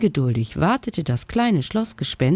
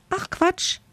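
much higher, squeaky little bat scoffing; squeaky bat call. Acted. Bat Frieda scoffs at the ghost from above, squeaky. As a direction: call it down high and dismissive. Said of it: "rief nun Frieda von oben".

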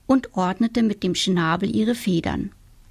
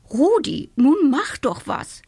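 higher, pleading hen voice; urging hen; 6 semitones higher than usual. A narrator's reading. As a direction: urge the rooster on as a worried hen. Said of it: "meinte die Henne Agathe".

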